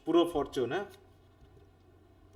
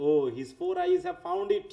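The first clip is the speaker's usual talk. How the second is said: high reading; 13 semitones higher, much slower than usual. Shown as a demonstration. Teacher reading a Volpone aside, high pitched. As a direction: read high and slow. Teacher reads Corvaccio's line aloud.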